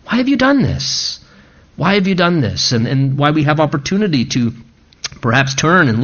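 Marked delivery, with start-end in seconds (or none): 0.1-1.2 pitched up high in disbelief; a high, aching why; high pleading cry. Acted. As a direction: cry out Why have you done this, high and pained. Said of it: "he challenges us in that way".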